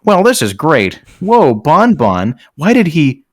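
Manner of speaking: ordinary delivery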